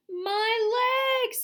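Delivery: high, loud shriek of rage; angry scream. A narrator's reading. Centipede shrieking at the paint on his legs. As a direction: shriek in fury. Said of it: "screamed with anger".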